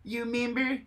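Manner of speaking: high whispery member berries. Acted. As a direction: coo it like the creepy grape. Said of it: "my attempt at member berries".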